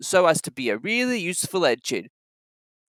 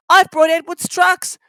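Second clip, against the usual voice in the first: high, louder small-engine voice; Thomas; 13 semitones higher, 7 dB louder than usual. A narrator's reading. Thomas answering the director, high voice. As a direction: answer nervously. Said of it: "Thomas answered".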